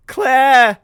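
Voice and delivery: very loud, high yell; shouting a name. Acted. Jamie yells at Claire over the bag, shouting. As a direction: yell the name at full force.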